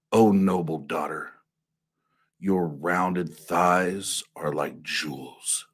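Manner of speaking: slow, sultry radio announcer; sexy radio voice. Acted. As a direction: drag each phrase out seductively. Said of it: "my sexy radio voice".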